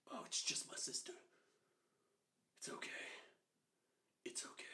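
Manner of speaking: nervous, soft whisper with long pauses; barely voiced, halting reassurance; hushed self-reassurance. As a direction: whisper it to yourself to calm down. Child nervously reassuring himself in bed.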